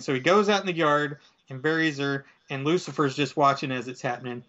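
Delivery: usual speaking voice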